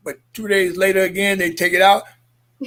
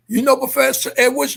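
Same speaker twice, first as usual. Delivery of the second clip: urgent retold questioning; 6 semitones higher than usual. Questioning father.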